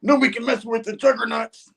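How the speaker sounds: high put-on hero voice; heroes retorting. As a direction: talk back like a cocky hero. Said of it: "are both like".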